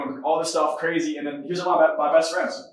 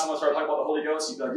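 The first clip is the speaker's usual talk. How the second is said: usual speaking voice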